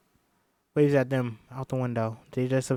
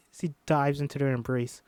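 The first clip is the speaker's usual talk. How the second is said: ordinary delivery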